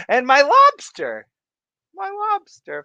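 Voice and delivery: high, loud squeal of the name; excited squeal. Real, not acted. Streamer squealing a viewer's name with delight.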